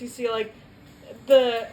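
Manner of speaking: usual speaking voice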